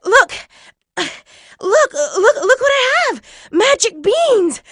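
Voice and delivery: put-on high, loud boy gasping with excitement; panting, thrilled yell; breathless excited shout. A narrator's reading. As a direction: burst out with it, winded from running. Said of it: "out of breath from his run".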